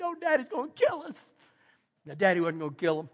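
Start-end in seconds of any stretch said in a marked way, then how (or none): 0.0-1.1 put-on higher, quick little-kid voice, softer; scared kids. Kids in the back seat fearing their dad, small worried voice. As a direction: squeak it out like a frightened child.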